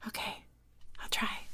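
very soft, tentative whisper; barely voiced, hushed reply. Shown as a demonstration. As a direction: drop to a bare whisper. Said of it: "Like whisper?".

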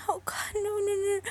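fearful loud pleading; frightened protest. Acted. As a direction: whisper rising into a loud panicked no. Gun pressed to temple.

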